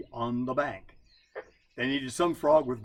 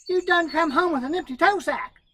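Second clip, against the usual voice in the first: much higher and louder, sharp demand; demanding wife; 9 dB louder than usual. Acted. Wife confronting husband over empty sack, loud and high. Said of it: "she would demand".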